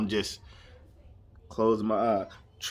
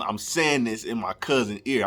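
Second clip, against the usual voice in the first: ordinary talk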